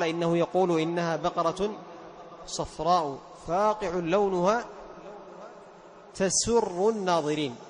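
slow recitation with تسر as tusirru, damma on ta and kasra on sin; wrong vowels in tusirru. Shown as a demonstration. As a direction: say تسر with u then i, changing joy into secrecy. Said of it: "يضم التاء ويكسر السين".

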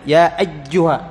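"أيها" mispronounced as aj-juha; ya turned jeem. Shown as a demonstration. Teacher shows the error, "أيها" with jeem for ya. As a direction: close the doubled y in "أيها" into a hard j. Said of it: "صارت جيم".

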